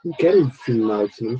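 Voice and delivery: ordinary talk, nothing marked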